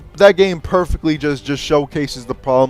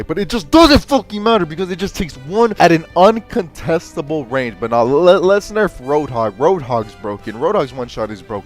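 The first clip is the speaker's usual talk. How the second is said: ordinary talk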